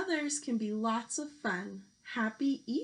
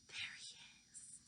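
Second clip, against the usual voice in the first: hushed, excited whisper; 18 dB quieter than usual. Acted. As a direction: whisper it like spotting him in secret. Easter Bunny arrives in story.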